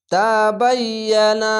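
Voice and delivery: slow tajweed word with held vowels; Quran recitation. Shown as a demonstration. Teacher modelling a word for students, slow recitation. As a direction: recite it evenly, stretching each long sound.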